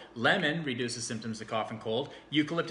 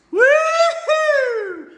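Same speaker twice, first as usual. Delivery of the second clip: high, loud laughing woo-hoo; excited whoop; 16 dB louder than usual. Real, not acted. Unboxing vlogger whooping with joy at the oil. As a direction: cheer with delight.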